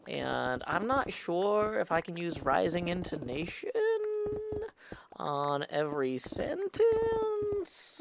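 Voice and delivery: every phrase slowly rising up high; rising intonation. Shown as a demonstration. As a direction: let the pitch climb on every word. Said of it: "I would have to use rising intonation".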